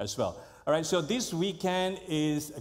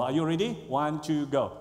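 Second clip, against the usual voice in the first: ordinary talk, nothing marked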